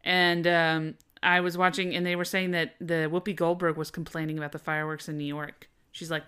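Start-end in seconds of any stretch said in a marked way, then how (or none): none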